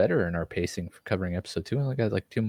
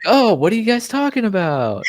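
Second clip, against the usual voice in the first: innocent act; 8 dB louder than usual. Retold or imitated. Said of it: "I was like".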